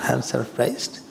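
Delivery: amused, coming down from laughter; real chuckle under the words; laughing. Real, not acted. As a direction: say it through leftover laughter. Still laughing after teasing a listener.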